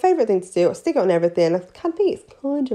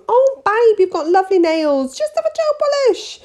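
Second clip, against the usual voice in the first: high, sugary nail tech coaxing a client; sweet salon voice; 13 semitones higher, 4 dB louder than usual. Retold or imitated. Nail tech soothing a regular, high and sugary. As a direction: pitch it way up, all honey and charm. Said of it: "for years I'd said to 'em".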